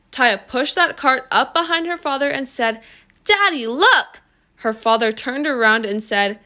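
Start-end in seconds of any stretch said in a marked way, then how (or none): 3.2-4.2 high, eager call to Dad; excited child calling. A narrator's reading. Daughter pushing the cart up behind father, excited. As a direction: call out high, rising on the second word.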